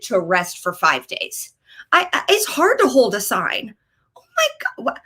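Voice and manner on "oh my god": very high strangled oh my God; exasperated squeal. Real, not acted. Comic complaining about protest fitness, high squeal. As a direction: squeak it out in disbelief.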